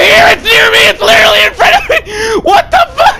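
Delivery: high, fast shrieking with laughter; screaming it's right in front of me; panicked screaming. Real, not acted. Object right in front of him.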